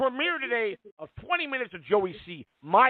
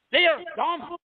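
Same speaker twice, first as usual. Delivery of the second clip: scornful; 10 semitones higher, slower than usual. Real, not acted. Host scorning trolls.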